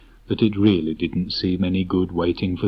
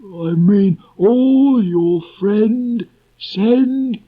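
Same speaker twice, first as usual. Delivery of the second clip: very high, loud, slow donkey voice; a gloomy donkey stumbling through verse; Eeyore reciting his poem; 11 semitones higher, 7 dB louder, much slower than usual. Acted. Eeyore reads his farewell poem to friends, slow and mournful.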